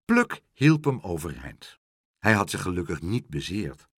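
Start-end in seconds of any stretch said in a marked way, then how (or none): none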